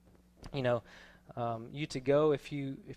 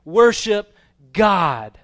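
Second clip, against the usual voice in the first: raised, emphatic scripture quote, building louder; angel's command; 5 semitones higher, 8 dB louder than usual. Acted. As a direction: build to a forceful closing command. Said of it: "But he said to me".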